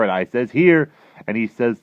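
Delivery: loud, animated storytelling; dream label read aloud. A narrator's reading.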